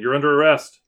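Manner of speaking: loud police-style call; called-out arrest. Acted. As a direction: shout it as an arresting officer. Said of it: "and voices saying".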